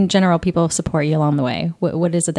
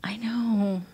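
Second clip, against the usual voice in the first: whispered; 2 semitones higher, 10 dB quieter, much slower than usual. Real, not acted. Quiet aside while friend talks.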